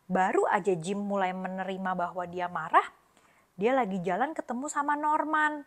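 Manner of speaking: usual speaking voice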